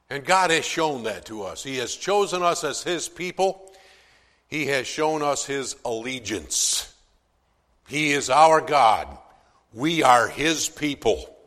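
loud, emphatic proclamation, slowing on key lines; preaching with force. Acted. Preacher declaring God's allegiance to his people, loud and emphatic. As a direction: drive each sentence home from the pulpit.

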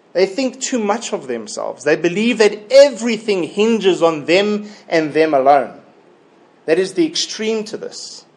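normal talking voice

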